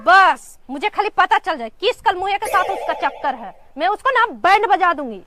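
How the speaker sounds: loud, indignant threatening; angry threat. Acted. Wife threatening whoever is seeing the neighbour, angry. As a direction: raise the voice and vow punishment.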